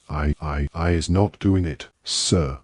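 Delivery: ordinary delivery, nothing marked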